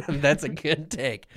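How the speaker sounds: normal talking voice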